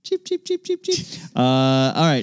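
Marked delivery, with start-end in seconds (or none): 0.0-1.1 high soft chick cheeps; baby chick peeps. Pretend. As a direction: peep like a little chick. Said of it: "do birds make that sound?".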